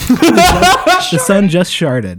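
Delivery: laughing through the words, breaking up; cracking up mid-sentence. Real, not acted. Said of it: "The sun is sharding everywhere".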